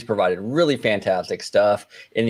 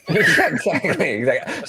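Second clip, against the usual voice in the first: laughing; 7 semitones higher, slower than usual. Real, not acted. Laughing agreement.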